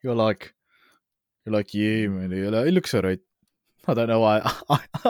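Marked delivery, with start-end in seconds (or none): 1.7-2.3 put-on lightly done South African accent; a hint of South African, casual. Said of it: "I gave you, like, a South African accent". 2.7-3.2 put-on South African accent. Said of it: "I gave you, like, a South African accent".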